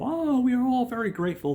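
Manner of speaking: hammed-up grateful elders; old elders gushing. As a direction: gush with gratitude like old rabbis. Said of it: "they're all".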